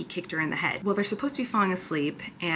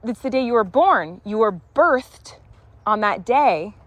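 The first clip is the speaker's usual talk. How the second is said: emphatic explaining; 2 semitones higher, 8 dB louder than usual. Real, not acted. Explaining pun to toddler.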